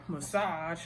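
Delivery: normal talking voice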